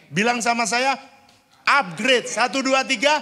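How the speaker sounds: loud, high call-and-response cue; rallying shout. Real, not acted. Preacher getting the congregation to repeat after him, rallying shout. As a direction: fire up the crowd to shout back.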